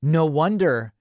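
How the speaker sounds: usual speaking voice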